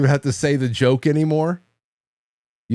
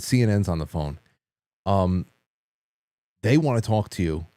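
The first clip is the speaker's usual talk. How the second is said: low, halting, grave delivery; understated mock-solemn news-breaking; deadpan serious; 4 semitones lower than usual. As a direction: sell the prank with a straight face. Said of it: "and I was very serious".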